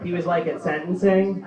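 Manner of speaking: ordinary talk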